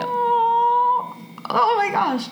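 high, emotional coo; high-pitched "aww" reaction, near tears; touched. Real, not acted. Moved by touching story.

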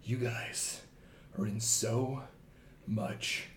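low, slow, quiet growl; menacing ogre growl. Acted. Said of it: "he growls and says".

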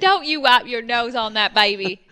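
loud hillbilly scolding; hillbilly accent. Retold or imitated. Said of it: "The hillbilly accent".

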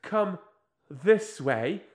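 slow, halting Australian failing at American; terrible wobbly Australian-American accent, hammed up; botched American accent. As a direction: mangle an American accent as an Australian actress, slowly. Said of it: "an Australian actress trying to do an American accent".

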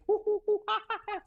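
very high ape hoots; ape noises. Retold or imitated. Host imitating his ape call to the stage, high hoots. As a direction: hoot high like an ape calling the troop. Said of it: "I would start doing".

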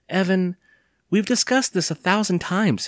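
high-pitched, impatient mother. A narrator's reading. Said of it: "his mother said impatiently".

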